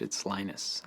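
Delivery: "Linus" said the English way, LYE-nus; English pronunciation. Shown as a demonstration. Linus telling the interviewer his name in English, LYE-nus. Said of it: "When I speak English".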